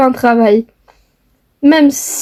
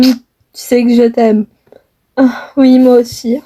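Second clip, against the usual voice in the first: ordinary talk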